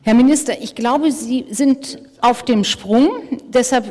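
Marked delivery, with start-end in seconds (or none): none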